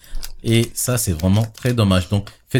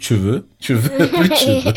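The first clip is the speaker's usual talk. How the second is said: laughing; 9 semitones higher than usual. Real, not acted. Joke about hair disappearing.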